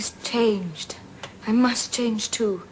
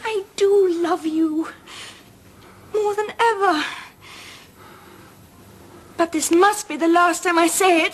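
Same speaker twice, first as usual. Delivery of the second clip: anguished; 6 semitones higher, 4 dB louder than usual. Acted. Jane's anguished declaration.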